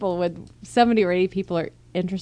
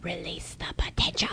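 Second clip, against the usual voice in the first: whispered mock slogan; 7 dB quieter than usual. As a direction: breathe it like a secret motto.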